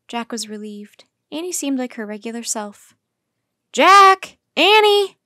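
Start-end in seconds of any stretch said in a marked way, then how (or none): 3.7-5.2 loud, high call of two names; shouting. A narrator's reading. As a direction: shout for them across the camp. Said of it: "Charlie shouted again".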